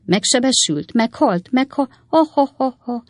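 high-pitched rising hysteria into mock laughter; hysterical aunt. A narrator's reading. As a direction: work up from faint protests to a spelt-out hysterical ha-ha. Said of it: "görcsös nevetési rohama, hisztérikusan hahotázott". (magas, hisztérikus hang, hahotába fúlva)

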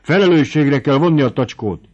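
hangosabb, felcsattanó düh; dühösen követeld. A narrator's reading. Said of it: "mondá dühösen Palojtai". (Palojtai dühösen követel, hangosan)

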